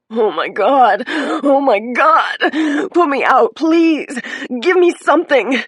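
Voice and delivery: high, loud sobbing wails; crying. A narrator's reading. Injured woman crying to be put out. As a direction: cry and beg through pain. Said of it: "who was crying".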